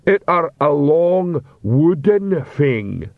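slow, dim, polite troll; a lumbering troll being apologetic. A narrator's reading. Troll voiced.

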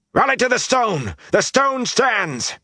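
high, urgent rallying shout; shouted battle cry. Acted. Rand must keep the defenders together, shouting battle cries. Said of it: "Those were the battle cries".

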